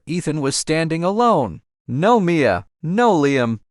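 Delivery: usual speaking voice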